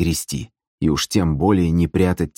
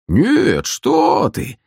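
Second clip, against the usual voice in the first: loud, high, fake-cheerful exclamation; feigned joy; 10 semitones higher, 4 dB louder, much slower than usual. A narrator's reading. Gusli player reassuring the sister, fake joy. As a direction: exclaim with overdone delight. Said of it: "с наигранной радостью воскликнул Вадзим".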